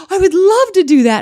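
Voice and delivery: big gasp, then high loud gushing; imitated excited young girl. Young girl longing to be like her, high excited gushing. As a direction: gasp and burst out with admiration. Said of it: "that young girl was like".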